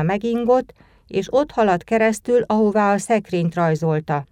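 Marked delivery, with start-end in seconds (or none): none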